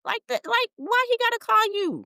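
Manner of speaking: high-pitched, incredulous questioning. Real, not acted. Host scoffing at why [name] should call him, incredulous. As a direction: shoot up high in disbelief. Indignant retort to the retold claim.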